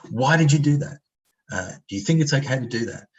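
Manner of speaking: scolding. Retold or imitated. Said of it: "we do this to kids all the time".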